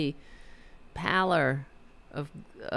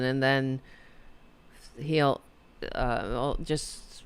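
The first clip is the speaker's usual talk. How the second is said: ordinary talk, nothing marked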